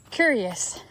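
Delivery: normal talking voice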